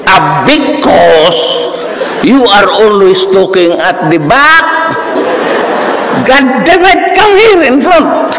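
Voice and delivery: high, slow, shrill scolding; shrill professor. Retold or imitated. Professor calling the student to the front, shrill. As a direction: berate him in a high screech. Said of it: "boses nito, shrill".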